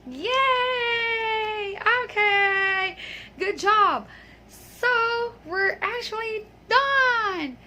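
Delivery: high, drawn-out celebratory cheer; excited cheer. Real, not acted. Teacher praises learners as the lesson is done, excited cheer. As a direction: cheer them on with long, high vowels.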